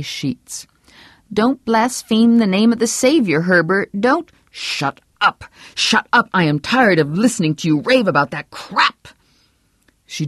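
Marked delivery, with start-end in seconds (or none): 1.3-4.3 higher, prim scolding wife; a devout wife rebuking her husband's blasphemy; pious wife voice. A narrator's reading. Vera scolding Herb for blaspheming, prim and high. 4.5-9.1 furious, rising shout of shut up; angry outburst. Acted. Husband silencing his wife's raving, angry.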